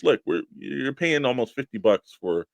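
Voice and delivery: ordinary delivery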